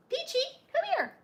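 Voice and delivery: high, sing-song call to the dog; high coaxing call to the dog; calling dog sweetly. Real, not acted. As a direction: coax the dog over in a high voice.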